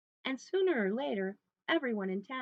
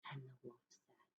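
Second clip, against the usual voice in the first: usual speaking voice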